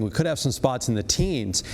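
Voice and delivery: usual speaking voice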